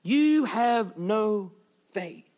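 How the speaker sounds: very slow, drawn out "You have no faith" with a hushed "faith"; slow emphatic. Shown as a demonstration. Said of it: "Now remember that part".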